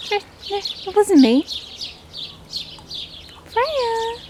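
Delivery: very high squeaky denial; mock innocent duck. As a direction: play the duck protesting it wasn't him. Voicing the duck's denial.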